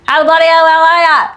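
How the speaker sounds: loud, high, run-together Australian holler; Australian movie character. Retold or imitated. Quoting an Australian from a film, loud slurred Australian accent. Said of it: "there's an Australian and he comes".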